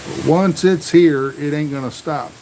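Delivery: usual speaking voice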